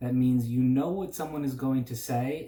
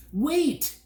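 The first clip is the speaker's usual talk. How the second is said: very high, loud call after him; shout; 5 dB louder than usual. A narrator's reading. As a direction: call him back as he flies off. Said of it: "shouted Milo".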